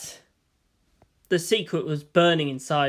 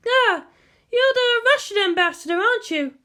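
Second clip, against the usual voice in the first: much higher woman's voice, guarded; cool and unimpressed; wary woman officer; 3 dB louder than usual. Acted. The Major sizing up the ambassador, wary.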